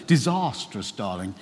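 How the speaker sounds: a light dry mock judge's verdict, falling; Strictly judge impression. Preacher jokingly mimics a Strictly judge, mild mock verdict. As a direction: drop it like a TV dance judge, lightly. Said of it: "watching far too much Strictly".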